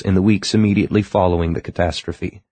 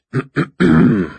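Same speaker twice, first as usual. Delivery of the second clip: loud, indignant throat clear; outraged throat clearing; 7 semitones higher, 4 dB louder than usual. Acted. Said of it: "an outraged".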